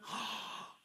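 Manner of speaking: sharp mock gasp of delight. Pastor imitating Americans, a hammed-up gasp. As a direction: gasp like an American opening a gift. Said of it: "막".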